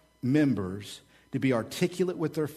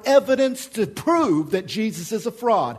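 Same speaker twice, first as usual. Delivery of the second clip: scoffing skeptic; 9 semitones higher, 5 dB louder than usual. Acted. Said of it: "look at us and say".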